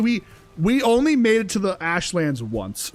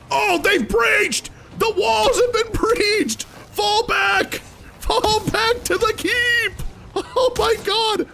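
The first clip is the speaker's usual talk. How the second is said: panicked soldier shouting; 14 semitones higher, slower than usual. Acted. Helm's Deep defence, shouting alarm.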